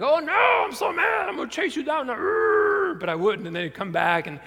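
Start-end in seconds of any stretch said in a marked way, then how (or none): 0.0-2.9 high, loud, hammed-up fake fury; mock angry shout. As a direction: roar it like a cartoon villain pretending to be mad. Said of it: "I was like".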